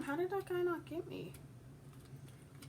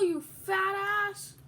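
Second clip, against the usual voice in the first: mocking insult; 6 semitones higher, 6 dB louder, slower than usual. Real, not acted. Insulting rival player.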